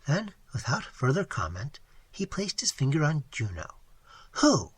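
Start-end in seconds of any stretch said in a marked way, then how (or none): 4.4-4.7 a higher, louder, insistent who; pressing the Indian's question hard; emphatic. A narrator's reading. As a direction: demand an answer. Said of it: "he inquired emphatically".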